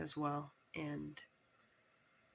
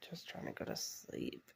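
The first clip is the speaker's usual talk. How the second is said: whispering; 4 semitones lower than usual. Retold or imitated. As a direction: hush it like talking in bed. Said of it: "Sometimes I'm just like".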